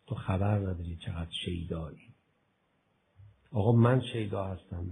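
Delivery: normal talking voice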